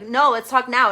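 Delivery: insistent demand. Retold or imitated. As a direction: push back hard, right now. Destiny insisting.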